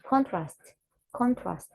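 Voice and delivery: CONtrast, first syllable stressed, falling away. Shown as a demonstration. Teacher showing students the word contrast, stressed on CON. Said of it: "stress the sound in the beginning of the word".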